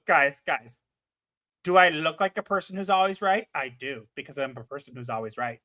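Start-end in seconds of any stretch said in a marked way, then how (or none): 3.5-5.6 smug aside. Acted.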